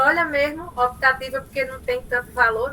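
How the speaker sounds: ordinary delivery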